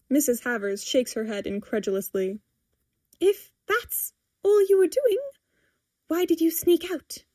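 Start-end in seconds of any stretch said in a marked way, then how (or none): 3.2-7.2 high-pitched exasperated questioning; disbelieving challenge. A narrator's reading. Mother questioning why daughter snuck out, exasperated. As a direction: press for an answer, pitched up.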